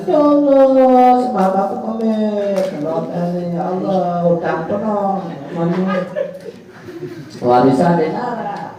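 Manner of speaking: hammed-up crying widow, pitch high; mock wailing. Preacher mimics wife crying after husband dies, mock wailing. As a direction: wail like a widow, mock sobbing. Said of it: "dia nangis-nangis".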